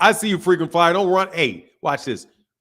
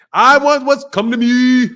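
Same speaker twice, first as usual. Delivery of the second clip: put-on African accent; 9 semitones higher, 5 dB louder, slower than usual. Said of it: "I don't even do a good a-, African accent".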